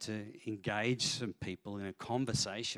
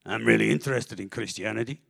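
lightly imitated German accent, louder; 7 dB louder than usual. German executive showing interest to the pastor, German accent. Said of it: "thick, very thick German accent".

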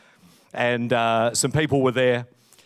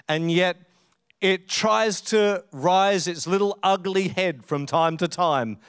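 ordinary talk

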